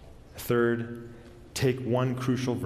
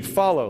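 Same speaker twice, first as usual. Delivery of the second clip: "follow" lifted higher and louder, a slow lingering fall; stress on follow; 5 semitones higher, 6 dB louder than usual. Shown as a demonstration. Preacher showing how to dwell on follow. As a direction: hang on the word "follow". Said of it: "Let's just stop with "follow".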